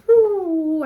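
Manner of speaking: high, loud "woo" of pain; whooped reaction to a jolt of pain. Retold or imitated. Reliving pain on the ball.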